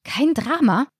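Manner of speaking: high, incredulous question; indignant echo. A narrator's reading. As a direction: throw the words back, outraged.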